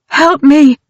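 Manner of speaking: very high loud terrified cry; desperate scream. A narrator's reading. Dawn pinned on the stairs crying for help, terrified scream. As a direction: shriek for your life.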